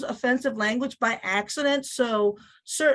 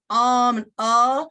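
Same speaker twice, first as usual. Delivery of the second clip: slow, louder drawn-out um and uh; mock fillers; 6 dB louder, slower than usual. Shown as a demonstration. Teacher re-enacts her own fillers for the class. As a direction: stretch the fillers out on purpose. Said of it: "So when I said".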